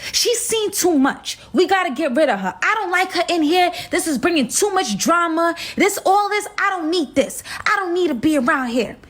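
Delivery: shouting to get rid of the intruder; retold scream. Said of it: "all I hear is her scream out".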